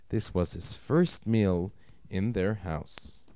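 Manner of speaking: ordinary delivery, nothing marked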